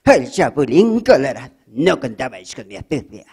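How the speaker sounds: put-on Castilian dub accent, ugly hero voice; Spain-dubbed Chapulín. Chapulín introducing himself in a Castilian dub accent. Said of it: "fea la voz del Chapulín".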